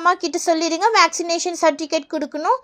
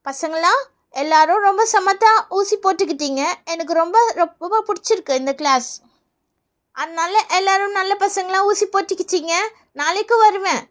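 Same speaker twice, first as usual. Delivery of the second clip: normal talking voice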